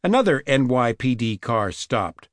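usual speaking voice

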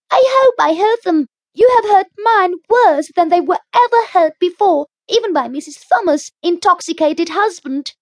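put-on high, loud indignant child; a hurt girl swearing revenge; furious girl. A narrator's reading. Anne's outburst.

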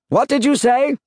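high, loud call; shouted query. A narrator's reading. Husband upstairs did not hear his wife, shouted query. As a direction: call down, having missed the words.

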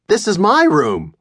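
put-on boy telling the intruder off; indignant boy. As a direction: stake your claim, scared but cross. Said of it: "I said".

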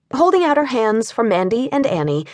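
plain reading voice

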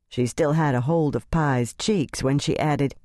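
usual speaking voice

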